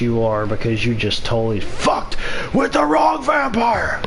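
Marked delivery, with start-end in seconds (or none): 2.5-4.0 mock villain shout. Gloating over a kill.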